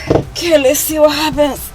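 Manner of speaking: usual speaking voice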